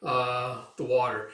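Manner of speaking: usual speaking voice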